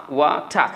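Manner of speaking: "ওয়াটা" with a silent final r; British-style "ওয়াটা", two clear syllables; British accent. Shown as a demonstration. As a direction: drop the r, end "ওয়াটা" on ah. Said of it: "শেষে রটা বাদ দিচ্ছেন".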